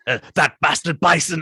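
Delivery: loud, hammed-up mock outrage; imitated movie-hero outburst; over-the-top action hero. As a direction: ham it up like a cheesy action-film star. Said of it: "so over the top immediately".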